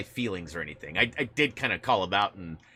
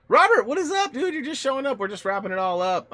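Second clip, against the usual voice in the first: excited greeting; 14 semitones higher, 6 dB louder than usual. Real, not acted. Greeting a late viewer.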